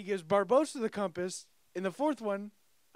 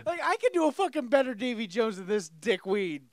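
loud, high-pitched outburst; indignant rant; 8 semitones higher, 10 dB louder than usual. Real, not acted. Podcaster sure he does Davy Jones better, indignant rant. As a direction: rant in outraged disbelief. Ranting about a bad impersonator.

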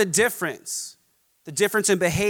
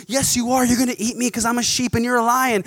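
higher, fast, anxious little cub voice; scared lion cub; 5 semitones higher, faster than usual. Acted. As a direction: blurt it out like a frightened cub. Cub insists it is a sheep.

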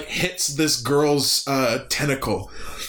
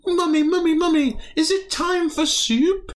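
put-on very high squeaky alien kid voice; high little alien asking mummy; alien child. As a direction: pipe up like a tiny alien child. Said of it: "alien go".

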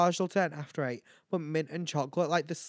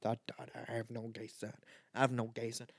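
lower-pitched imitation of a man from a video; viral video man; 4 semitones lower, 8 dB quieter than usual. Host quoting a favourite TikTok video, imitating the man. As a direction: quote the TikTok man, low and deliberate. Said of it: "That man right there on that video".

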